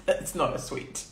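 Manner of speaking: usual speaking voice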